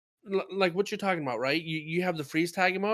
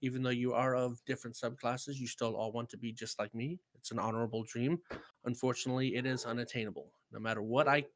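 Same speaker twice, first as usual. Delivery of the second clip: low, hushed, grandiose legend voice; epic fantasy narrator; 5 semitones lower, 9 dB quieter than usual. Pretend. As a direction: speak as a mythic hero boasting. Grandiose self-introduction as hunter legend.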